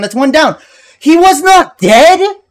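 high furious disbelief; raging gamer. Real, not acted. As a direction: shout it in outraged disbelief. Boss survives, gamer rages.

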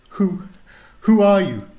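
put-on high, loud scared little girl; frightened girl. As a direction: stammer it out in fright. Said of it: "she said".

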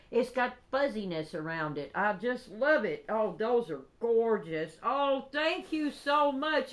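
ordinary delivery

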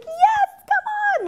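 squealed, very high and loud yes, come on; high-pitched welcome. Retold or imitated. Imitating Tana greeting fans, very high and bright. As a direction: mimic an eager friend at top pitch. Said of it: "And she's like".

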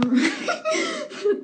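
high-pitched laugh; burst of laughter. Real, not acted. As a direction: break into a high, sudden laugh. Breaks off into laughing.

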